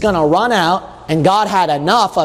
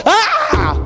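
high laughing yelp of frustration; a fed-up parent's burst; frustrated outburst. Retold or imitated. Parent finally losing patience, high laughing shout. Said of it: "You're gonna go".

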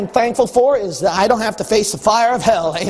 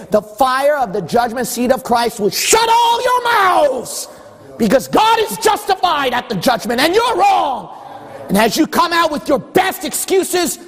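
preaching at full force; 6 semitones higher than usual. Real, not acted. Preacher warning the congregation about judgment, full-force preaching shout.

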